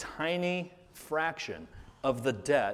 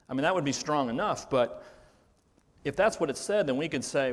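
usual speaking voice

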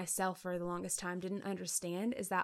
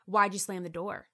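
louder, blaming demand; accusing; 7 dB louder than usual. Retold or imitated. To husband about the slammed door, accusing and louder. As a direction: confront him with it. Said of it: "I could have said".

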